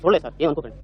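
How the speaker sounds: usual speaking voice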